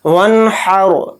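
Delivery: वनहर with the हा pushed from the throat and a heavy रा; throaty ha. Shown as a demonstration. Teacher showing the throat letter हा, slow. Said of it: "इस तरह से।".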